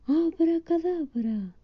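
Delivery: high, slow drawn-out magic word; the witch Winnie shouts her spell, high and slow; witch's spell call. Acted. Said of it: "y gritó".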